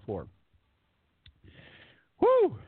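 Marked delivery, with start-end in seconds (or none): 2.2-2.6 whew of relief. Real, not acted. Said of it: "I think I'm gonna make it through".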